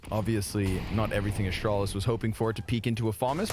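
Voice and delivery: ordinary delivery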